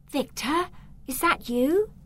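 put-on high girl's voice. Girl voiced in story.

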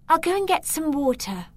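put-on high girl's voice. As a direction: say it brightly as a young girl. Girl voiced in story.

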